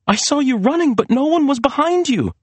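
very high, upset voice; confused protest. A narrator's reading. Serena contradicting Maria about the chase, confused protest. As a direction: contradict her in bewilderment.

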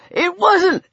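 high, strained desperate cry; choked pleading. A narrator's reading. Lang blames Weller as Peter squeezes, choking and pleading. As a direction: plead shrilly until the throat is squeezed shut. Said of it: "Lang's voice choked off".

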